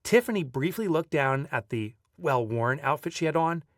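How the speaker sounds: usual speaking voice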